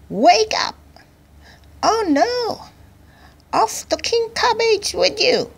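very high-pitched general's voice; rude cabbage general. A narrator's reading. General waking the king to take him prisoner, rude high voice. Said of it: "the victorious cabbage general rudely woke".

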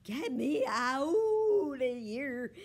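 loud, drawn-out cat howl; high, pleading yowl. A narrator's reading. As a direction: wail to be let out. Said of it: "Fiona howled".

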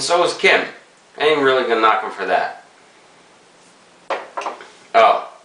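talking around pipe stem; pipe in mouth. Real, not acted. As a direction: lips gripping pipe. Seen 1: Pipe stem at or clenched between lips until about 5.2s.

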